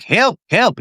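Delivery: high-pitched mock cry for help; face pleading for help. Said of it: "She's like".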